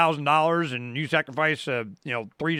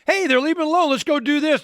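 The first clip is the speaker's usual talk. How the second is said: higher, louder, egging each other on; a hammed-up let's go; mock eager crowd; 10 semitones higher, 4 dB louder than usual. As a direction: play the neighbours rushing to copy it. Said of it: "then people go".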